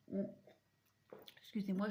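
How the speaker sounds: talking between sips of coffee; while sipping a drink. Real, not acted. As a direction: say it with the cup at your lips. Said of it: "je bois mon café en même temps". (en buvant une gorgée de café)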